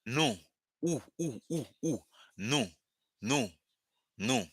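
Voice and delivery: "Nous" as a clean, rounded oo vowel; pronunciation drill. Shown as a demonstration. Teacher drilling Creole "Nous" for English speakers. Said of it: "It's pronounced nous".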